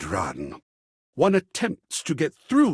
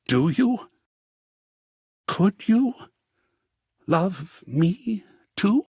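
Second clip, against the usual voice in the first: hesitant hope; 4 semitones higher, slower than usual. Acted. Artist confessing love.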